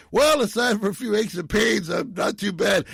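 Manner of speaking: a hammed-up old doctor cracking wise in court; jokey McCoy quip. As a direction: toss off the joke pleased with yourself. McCoy's joke quoted at trial.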